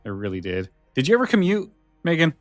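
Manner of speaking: normal talking voice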